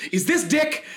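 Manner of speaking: high-pitched put-on voice asking; imagined caller. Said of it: "that voice comes over and she's like".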